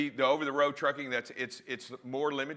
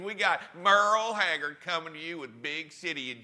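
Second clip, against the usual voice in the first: country DJ voice; 7 semitones higher, 4 dB louder than usual. Pretend. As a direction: ham it up like a country disc jockey introducing oldies. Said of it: "in your country D J voice".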